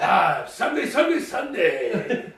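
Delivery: booming, high hype announcer call; monster truck announcer. Pretend. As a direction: yell it like an arena ad. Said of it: "like, monster truck announcers".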